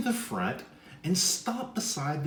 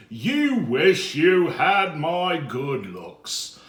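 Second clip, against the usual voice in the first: slow, louder vain gloating; proud boast; 3 semitones higher, 7 dB louder, slower than usual. Acted. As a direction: preen over your looks.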